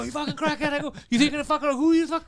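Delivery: high-pitched angry rant; acted-out furious insults; retold going crazy. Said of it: "So I was going crazy".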